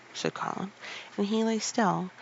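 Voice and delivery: usual speaking voice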